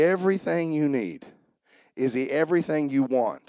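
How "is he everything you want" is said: normal talking voice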